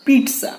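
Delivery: "pizza" as PEET-sa, high; TS pronunciation. Shown as a demonstration. Teacher naming what to buy, TS pronunciation. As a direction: say "pizza" with a crisp T-S.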